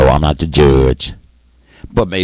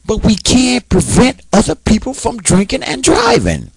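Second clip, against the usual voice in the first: high, heated pleading; angry rant. Real, not acted. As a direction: push it out in real anger. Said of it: "and I am angry".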